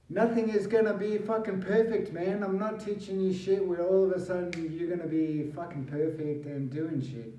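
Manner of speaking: fired-up motivational outburst; emphatic rant. Real, not acted. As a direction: hammer the point home, almost shouting. Ranting life lesson to viewers.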